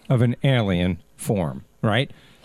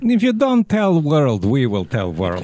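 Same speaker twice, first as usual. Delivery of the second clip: put-on slow Russian accent, pitch dropping into the threat; comic Russian heavy, stretched-out accent; mock Russian accent. Acting out the Russian ultimatum.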